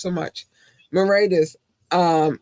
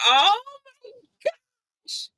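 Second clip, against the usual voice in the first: crying; much slower than usual. Real, not acted. Overwhelmed by listener love.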